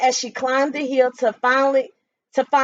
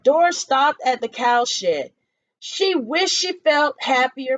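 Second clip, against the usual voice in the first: ordinary delivery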